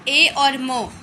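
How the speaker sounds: usual speaking voice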